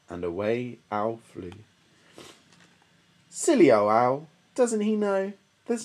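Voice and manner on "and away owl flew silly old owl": ordinary delivery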